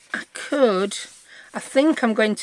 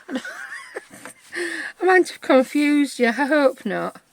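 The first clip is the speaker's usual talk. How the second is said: laughing; 6 semitones higher, much slower than usual. Real, not acted. Laughs at own confusing demo.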